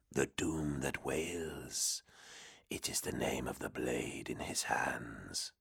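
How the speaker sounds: low, breathy whispering demon. Acted. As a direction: hiss it like a hidden demon. Demon explains.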